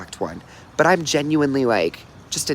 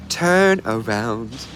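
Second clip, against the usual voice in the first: slow, drawn-out command; much slower than usual. Shown as a demonstration. Viewer asked for it, so a drawn-out "Turn around". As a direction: stretch "Turn around" out slowly, voice rising at the end. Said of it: "Someone said".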